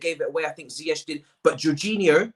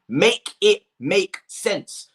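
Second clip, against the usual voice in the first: drawn-out plea; much slower than usual. Real, not acted. Exasperated refrain.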